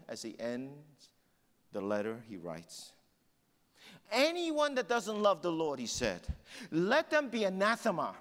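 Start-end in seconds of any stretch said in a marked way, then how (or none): 4.1-5.8 high, ringing preacher's declaration; impassioned quoted warning; forceful proclamation. Retold or imitated. Proclaims Paul's curse from the letter. 6.7-8.1 high, ringing preacher's declaration; impassioned quoted warning; forceful proclamation. Retold or imitated. Proclaims Paul's curse from the letter.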